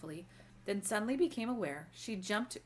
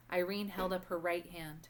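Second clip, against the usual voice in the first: ordinary delivery, nothing marked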